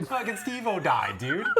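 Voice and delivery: laughing as you say it. Real, not acted. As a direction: break into laughter mid-line. Laughing at comrade's death.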